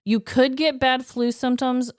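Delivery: ordinary talk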